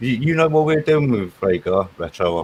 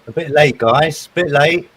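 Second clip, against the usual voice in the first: teasing scold; 5 semitones higher, 6 dB louder than usual. Real, not acted. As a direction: playfully tell the latecomers off. Teasing late arrivals.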